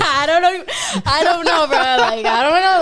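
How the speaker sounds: high, loud laughing through the words; can't stop laughing; laughing helplessly. Real, not acted. Laughing while telling friends about the lap dance, high and loud.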